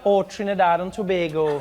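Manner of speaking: sing-song Trinidadian lilt; playful Trinidadian sing-song; Trinidadian accent. Retold or imitated. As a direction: imitate a lilting Trinidadian accent. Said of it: "I love how you guys say that".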